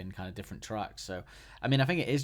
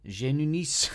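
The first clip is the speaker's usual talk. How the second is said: "Genunis" said in a hammed-up French accent, higher and louder; mock French accent; 6 semitones higher, 7 dB louder, slower than usual. Host unsure of a South American venue name, mock French accent. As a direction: give "Genunis" a playful fake French accent. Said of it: "saying it in a French accent".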